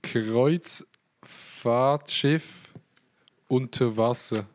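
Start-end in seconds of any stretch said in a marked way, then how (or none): 0.0-2.7 drawn out. Pretend. Dramatic slow reading out search.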